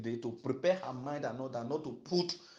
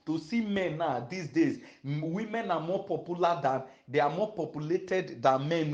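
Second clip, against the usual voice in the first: ordinary talk, nothing marked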